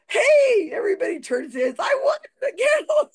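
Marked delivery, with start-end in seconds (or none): 0.1-0.7 much higher, louder triumphant yell; drunken whoop. Retold or imitated. Drunk man celebrating his prize to the room, loud whoop. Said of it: "and the guy's all". 1.8-3.1 put-on much higher, louder drunken pride; proud drunk. Drunk man bragging to the audience, loud proud cry. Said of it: "and the guy's all".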